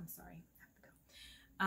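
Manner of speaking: real tears, words fading to a whisper; breaking off, fighting back tears; choked up. Real, not acted. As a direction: overcome and barely able to speak. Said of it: "now I'm getting emotional".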